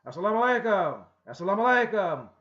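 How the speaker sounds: loud, high, emphatic salaam; emphatic greeting. Shown as a demonstration. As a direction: call out the greeting with force. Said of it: "with emphasis".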